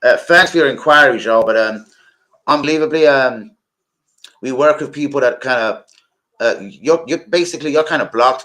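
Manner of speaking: hammed-up American rejection full of y'all; imitated American accent telling him to get lost. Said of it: "I can imagine how the accent would have been".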